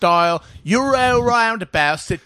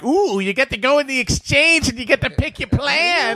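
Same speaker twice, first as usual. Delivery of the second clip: mock excited nerd; 8 semitones higher than usual.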